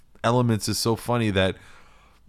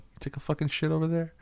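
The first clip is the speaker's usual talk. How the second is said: quiet, fast, higher cop question; serious cop voice; 8 semitones higher, 6 dB quieter, faster than usual. Retold or imitated. Cop leaning in close to suspect, quiet and intense. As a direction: lean in close and grill him. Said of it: "being like".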